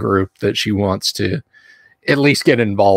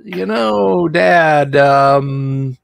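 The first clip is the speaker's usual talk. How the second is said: imitating daughter; 5 semitones higher, slower than usual. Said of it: "sauntered into my office and was like".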